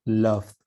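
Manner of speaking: Love with a voiced d-like final sound; love with d-like ending. Shown as a demonstration. Teacher showing students the final sound of Love. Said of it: "Como si fuera D, ¿no? Al final".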